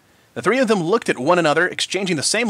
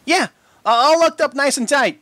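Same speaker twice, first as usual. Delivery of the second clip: quavering; 10 semitones higher, 4 dB louder than usual. A narrator's reading. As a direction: try to sound brave while shaking. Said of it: "in a quavering voice".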